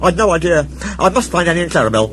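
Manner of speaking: high, hurried engine voice; a little engine trying to escape fast; flustered Thomas. Acted.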